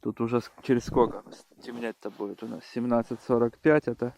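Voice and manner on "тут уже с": ordinary talk, nothing marked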